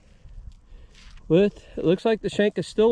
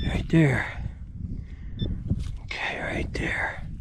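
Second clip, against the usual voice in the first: breathless whisper; hushed whisper over the find; breathless treasure hunter; 5 semitones lower, 7 dB quieter, slower than usual. Real, not acted.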